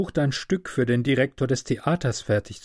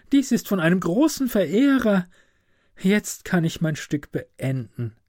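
delighted student, pitched higher; glücklich; 7 semitones higher than usual. A narrator's reading. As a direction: lift the voice in happy wonder. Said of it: "er sah sehr glücklich aus". (glücklich, mit gehobener Stimme)

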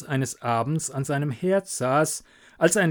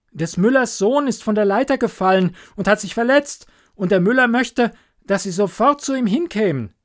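loud, much higher, fast; urgent shout; 8 semitones higher, 6 dB louder, faster than usual. Acted. Hans shouting the miller's request to the doctor, loud and fast. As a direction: hurry the news out over the wind.

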